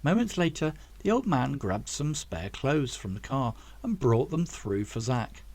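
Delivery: ordinary delivery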